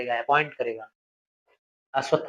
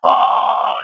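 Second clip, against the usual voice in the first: loud breathy animal roar; imitating a victor's bestial howl; beastly roar; 8 dB louder than usual. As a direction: let out a wild beast's howl. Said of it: "जोर से पशु की तरह चिल्ला रहा था".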